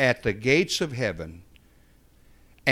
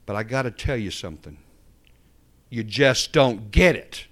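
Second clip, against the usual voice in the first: firm and building, louder at the end; 5 dB louder than usual. Retold or imitated. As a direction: lay it down firmly, not harsh. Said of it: "But I said it very firmly".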